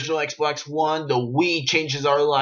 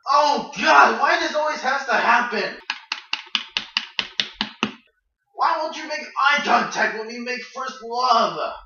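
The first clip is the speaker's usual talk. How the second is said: comic wail; 10 semitones higher, slower than usual. Acted. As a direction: howl in mock despair. Comic skit outburst.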